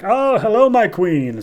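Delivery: high, loud, gushing hello; effusive acted greeting. Vandal approaching the Queen, hammed-up smooth greeting. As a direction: swoop up high, then settle into flattery.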